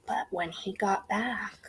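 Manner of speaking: slow soft whisper. Real, not acted. Teacher whispering the story to the class. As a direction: whisper it slowly. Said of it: "whispered narration".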